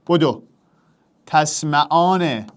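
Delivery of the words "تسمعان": «تسمعان» drawn out slowly; slow dictation. Shown as a demonstration. Teacher repeating the verb slowly for students to convert. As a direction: stretch each syllable for the class. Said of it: "تو امرش کن".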